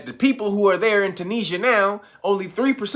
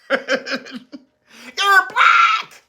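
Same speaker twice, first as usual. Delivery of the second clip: mock shriek; 12 semitones higher than usual. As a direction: shriek the last word. Critics' final outburst.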